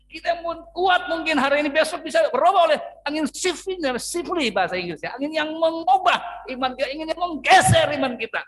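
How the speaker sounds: loud, high preaching about the winds of change; preaching at full force. Acted. Preacher warns the congregation about the shifting winds, at full force. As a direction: drive the warning home from the pulpit.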